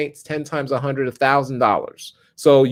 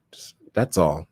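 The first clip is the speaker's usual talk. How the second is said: whispered aside; 9 semitones lower, 4 dB quieter than usual. Real, not acted. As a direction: whisper "that's all" under the breath. Quiet aside to viewers.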